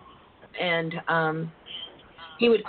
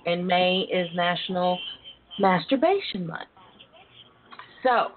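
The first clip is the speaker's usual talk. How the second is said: ordinary talk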